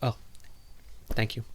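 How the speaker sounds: usual speaking voice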